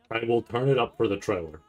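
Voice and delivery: ordinary talk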